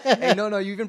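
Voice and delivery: loud, high cry of excitement to a friend; excited protest. Real, not acted. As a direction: jump in, thrilled. Excited praise after the freestyle.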